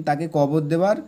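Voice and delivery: ordinary delivery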